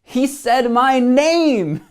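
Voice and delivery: thrilled. Retold or imitated. Telling friends a hero knew his name, thrilled. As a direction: savor the joy of being named.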